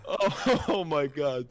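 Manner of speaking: high, laughed-out exclamation; real laughing. Real, not acted. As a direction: gasp it out while cracking up. Recovering from laughing fit.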